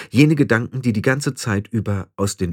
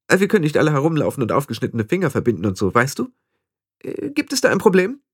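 put-on high, rapid, defensive young witch; conceited young witch, less sure; 6 semitones higher, faster than usual. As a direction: rattle it off in a high, snooty voice. (eingebildete junge Hexe, etwas verunsichert)

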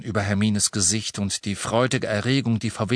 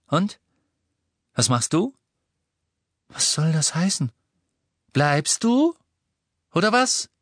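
raised, challenging questions, pitch jumping up; curt demand; 7 semitones higher, 3 dB louder than usual. A narrator's reading. Ron demanding whether Hermine stays, challenging raised voice. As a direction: press for an answer.

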